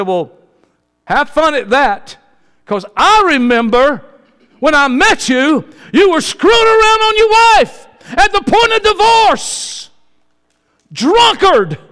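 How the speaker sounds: high, loud mocking rebuke from the pulpit; preaching at full force. Preacher rebukes a cheating drunkard before the church, loud and high. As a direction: let the congregation hear the scorn, loud and high.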